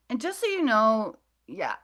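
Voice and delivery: ordinary delivery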